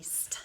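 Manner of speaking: "st" hissed softly, no voice; blend sounded out. Shown as a demonstration. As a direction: whisper the "st" blend. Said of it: "the blend S T".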